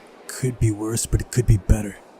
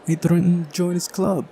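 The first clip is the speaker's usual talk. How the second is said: higher character voice, pleased with the trick; cheeky girl character; 7 semitones higher than usual. Acted. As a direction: play a mischievous girl boasting. Voicing Uliana's boast in visual novel.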